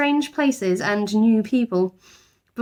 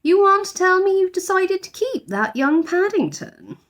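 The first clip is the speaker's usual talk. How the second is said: knowing housekeeper; 12 semitones higher than usual. A narrator's reading. Voices Mrs Bird.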